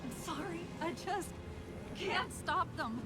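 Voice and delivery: high, soft, faltering confession; helpless apology. Acted. Failing AI confessing she has lost control, helpless apology. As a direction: apologise helplessly, halting between phrases.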